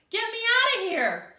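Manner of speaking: high, loud desperate shout. Acted. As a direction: yell it desperately. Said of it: "he shouted".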